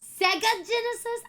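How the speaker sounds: high-pitched, tentative Canadian accent; questioning mock-Canadian accent; Canadian accent attempt. Shown as a demonstration. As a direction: try out a Canadian accent, unsure of it. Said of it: "Vegas with the Canadian accent".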